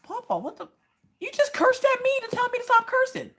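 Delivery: very high-pitched mock outrage; indignant protest. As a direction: protest in a squeaky, incredulous voice. Indignant protest to cursing dad.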